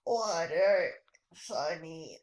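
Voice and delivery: low, hoarse dying croak; dying man's plea. Acted. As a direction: beg faintly from a parched throat. Dying burned man begs for water.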